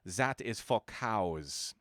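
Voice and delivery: put-on slow, deliberate German accent; a lightly voiced German professor proclaiming. A narrator's reading. Professor banning gum in class, mild German accent. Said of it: "he would proclaim in his German accent".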